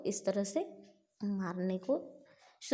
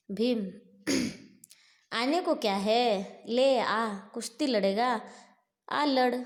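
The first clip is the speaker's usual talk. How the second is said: slow, booming demon challenge, loud and drawn out; demon's challenge; 5 dB louder than usual. Acted. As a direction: call Bhima out to wrestle like a monster. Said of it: "challenge देता है". (भीम को ललकारता धीमा, गरजता राक्षस)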